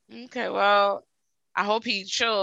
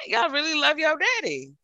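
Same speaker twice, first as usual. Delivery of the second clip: teasing; 9 semitones higher than usual. Retold or imitated. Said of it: "I'm like".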